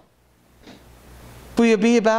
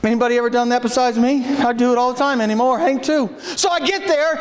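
usual speaking voice

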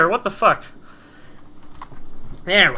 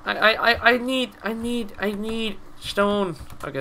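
ordinary delivery

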